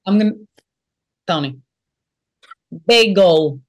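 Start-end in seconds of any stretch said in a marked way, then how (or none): 2.9-3.6 "Bagel" with a Brooklyn vowel and a hard dark L. Shown as a demonstration. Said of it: "Hard L".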